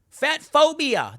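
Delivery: high, drawn-out mock outcry; mock accusation. Host quoting critics' accusation, mock outraged. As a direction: exclaim the label with scorn.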